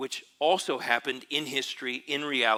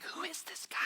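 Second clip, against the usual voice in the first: awed whisper; 12 dB quieter than usual. Retold or imitated. Disciples stunned after calmed storm.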